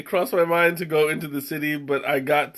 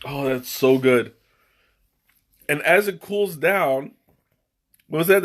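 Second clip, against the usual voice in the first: ordinary delivery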